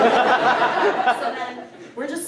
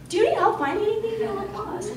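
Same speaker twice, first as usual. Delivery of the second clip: bright, high, quick helpful offer; helpful guide voice; 12 semitones higher than usual. Retold or imitated. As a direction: chirp it like an eager campus guide. Offering help to a lost man.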